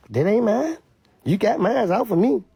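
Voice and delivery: acted denial. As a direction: protest it as the accused guard.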